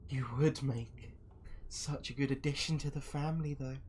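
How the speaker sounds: high, unhurried put-on voice; coaxing villainess. Masked woman eyes the guest for the family, high and coaxing. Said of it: "She's like".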